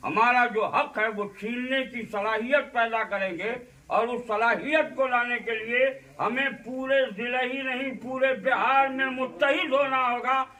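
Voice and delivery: rally the congregation to unite; rousing preaching. Acted. Preaches unity at full force.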